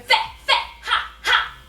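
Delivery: loud, explosive Italian-chef syllables; angry Italian chef. Shown as a demonstration. Class imitates angry Italian chef, explosive shouts. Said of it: "like an angry Italian chef".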